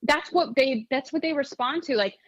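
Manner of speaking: ordinary delivery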